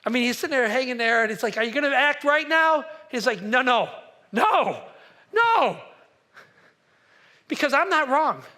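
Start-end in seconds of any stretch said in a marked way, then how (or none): 1.6-2.8 higher and louder jeering question; priest's taunt, pushed up loud; mock taunting shout. Taunt to the bound prophet. 3.4-8.4 loud, rising no, no, no; the prophet's stubborn loud refusal; defiant shout. Retold or imitated. Jeremiah defies the priest.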